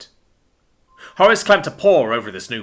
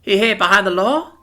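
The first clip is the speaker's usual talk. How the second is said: put-on questioning child; 9 semitones higher than usual. A narrator's reading. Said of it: "Scotty asked".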